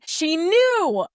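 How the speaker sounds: loud, high mock shout. Retelling the character's outburst, a loud high shout. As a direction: throw the line out like an accusation. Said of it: "And then she shouted".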